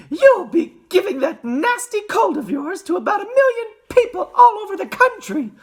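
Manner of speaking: shrieking, high-pitched scold; fussing mother at full pitch; Missus Gloop. A narrator's reading. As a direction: shout it very high and loud. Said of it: "shouted Missus Gloop".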